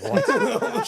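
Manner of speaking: very high, loud mock-shocked yelp; shocked shriek. Gym partner hears who is coming, shocked shriek. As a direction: shriek it in hammed-up disbelief. Acted shocked reaction to the news.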